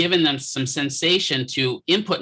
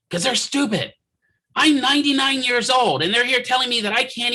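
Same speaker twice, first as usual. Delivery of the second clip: higher, mock cranky old woman building to a shout on the last line; ranting old patient; 8 semitones higher than usual. Old patient complains about the dietitians, rising to a mock shout.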